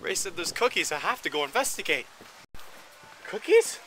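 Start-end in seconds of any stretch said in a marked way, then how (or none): 3.3-3.8 excited. Real, not acted. Hears there are cookies.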